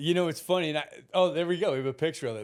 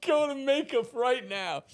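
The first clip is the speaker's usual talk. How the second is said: higher, slower, firm command; stern director order; 8 semitones higher than usual. Retold or imitated. Director seeing the dark tan, firm slow command. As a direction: order him straight to makeup.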